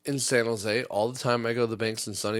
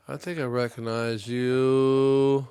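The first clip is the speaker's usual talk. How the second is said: slow, louder, drawn-out mock teller voice; drawn-out suspicious teller; 4 dB louder, much slower than usual. To the customer, a slow mocking suspicious teller.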